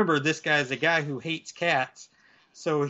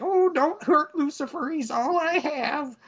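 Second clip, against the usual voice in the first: put-on pleading old lady; 12 semitones higher than usual. Old lady pleads for her cat, very high pleading voice. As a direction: beg in a high-pitched old-woman voice. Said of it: "So she's like".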